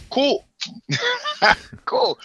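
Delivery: high, loud one-word reply with laughter; mock eager acceptance. Podcaster voicing the reply to the banks' offer, high and mock-eager.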